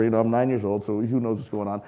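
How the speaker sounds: normal talking voice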